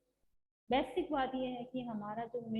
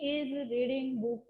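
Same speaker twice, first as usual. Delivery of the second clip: each word spelled out slowly for students; slow teacher dictation; much slower than usual. Shown as a demonstration. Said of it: "जैसे he".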